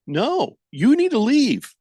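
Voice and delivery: mock outraged dismissal. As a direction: throw them out in mock indignation. Acted rejection of accentless king.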